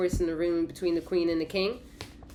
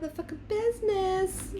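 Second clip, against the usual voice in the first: mock high swear. Punchline in put-on voice.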